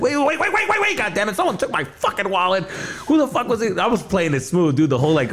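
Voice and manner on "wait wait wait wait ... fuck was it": high-pitched, agitated pleading; frantic protest. Retold or imitated. Customer telling security about stolen wallet, frantic.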